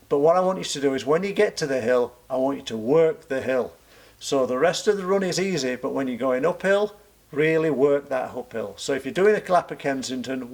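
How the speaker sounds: normal talking voice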